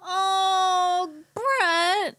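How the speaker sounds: high, touched "Oh, Brett"; overwhelmed by the reading; moved gasp. Real, not acted. Co-host reacts to the story, high and touched.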